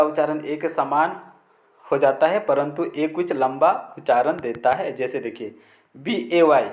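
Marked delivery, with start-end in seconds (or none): none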